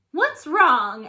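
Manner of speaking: loud sharp question; monster teacher asking. A narrator's reading. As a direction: demand it sharply. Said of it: "asked Missus Green".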